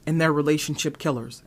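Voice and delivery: ordinary talk, nothing marked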